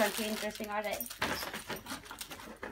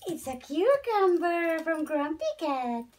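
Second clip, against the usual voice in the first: louder, slower, higher show-and-tell; excited pet talk; 5 semitones higher, 6 dB louder than usual. Acted. Owner showing her pets a cucumber toy, excited. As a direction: announce it slowly and louder, delighted.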